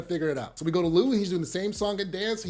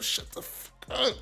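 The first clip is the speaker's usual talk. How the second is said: slow hissed whisper; mouthed whisper; 4 semitones higher, 6 dB quieter, slower than usual. Retold or imitated. Rock silently warning Kanan across the room, slow whisper. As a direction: breathe it through your teeth so only he hears.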